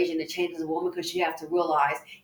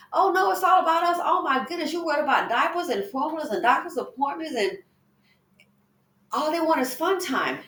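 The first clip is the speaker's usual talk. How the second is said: higher, fast, whiny mock voice of a man-child; mocking whiny men; 8 semitones higher than usual. Host mocks men who only want fun, whiny mock voice. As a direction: sneer it like a spoiled boy.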